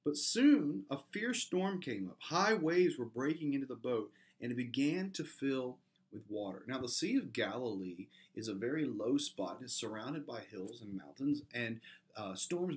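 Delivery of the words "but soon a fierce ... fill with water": dramatic reading. Acted. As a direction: make them feel the storm hit.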